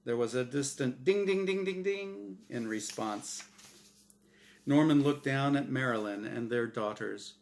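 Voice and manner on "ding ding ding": ordinary delivery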